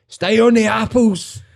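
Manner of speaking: high, drawn-out pub heckle; young team shout. Acted. Young team heckle an old man in the pub, high teasing call.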